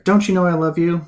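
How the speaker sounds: high-pitched imitation of an upset mother; exasperated motherly plea, pitched well up; strained, frustrated mom. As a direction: mimic a mother tensely professing love. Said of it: "just a lot of strain".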